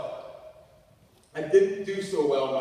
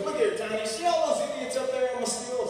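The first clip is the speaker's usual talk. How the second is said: high, raised foreman voice; 9 semitones higher than usual. Acted. Foreman pointing out the steel crew, high insistent voice. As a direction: pitch it up like an insistent boss. Redneck foreman explains the job.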